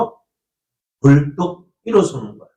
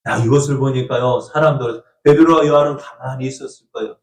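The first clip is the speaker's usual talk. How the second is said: ordinary delivery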